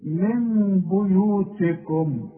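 من بيوتكم with من hummed as meem, slow; two-beat nasal hum on من; iqlab ghunna. Shown as a demonstration. Teacher recites Quran word for students, slow ghunna. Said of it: "كمان كلمة".

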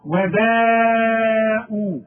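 وباءوا very slow and loud, vowel held long; long drawn recitation of وباءوا; long madd. Shown as a demonstration. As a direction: stretch وباءوا four counts. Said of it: "لازم تمد".

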